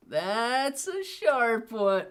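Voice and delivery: high, slowly stretched THAT'S A SHARP ONE; drawn-out exclamation. Pretend. Same words repeated, drawn out high.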